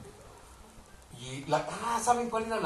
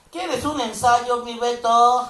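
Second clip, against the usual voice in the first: higher, slow, louder northern Mexican drawl; northern Mexican accent; 7 semitones higher, 10 dB louder, much slower than usual. Retold or imitated. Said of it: "con su más puro acento inglés".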